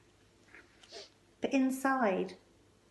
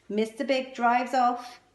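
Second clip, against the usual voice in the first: usual speaking voice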